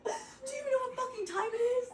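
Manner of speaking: high, fast, laughing through it. Real, not acted. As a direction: blurt it out cracking up. Helpless laughter.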